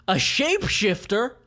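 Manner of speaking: high, startled mercenary; surprised. Acted. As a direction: caught off guard. Billy character voice.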